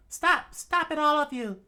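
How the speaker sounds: high falsetto scream, loud; shrieked command to a whole crowd; Tashigi. Acted. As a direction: scream the order in a put-on woman's voice. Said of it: "Tashigi screamed out".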